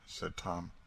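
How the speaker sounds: ordinary delivery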